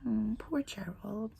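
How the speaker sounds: real whispered aside to the class; whispered sympathy. Real, not acted. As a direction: breathe it as a tender aside.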